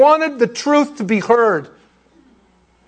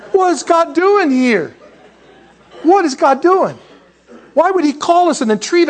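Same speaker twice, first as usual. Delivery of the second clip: mock lament; 9 semitones higher than usual. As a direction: whine it up to heaven. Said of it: "that's how we feel".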